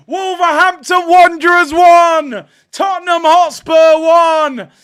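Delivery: high, loud, elated roaring; ecstatic celebration shout. Real, not acted. Streamer announcing Wolves equaliser to viewers, ecstatic shout. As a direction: shout every phrase like the goal just went in.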